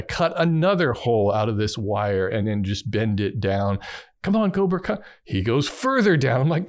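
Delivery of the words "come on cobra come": high-pitched retold call; coaxing a pet. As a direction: urge the cat through in a high voice. Retold coaxing the cat again.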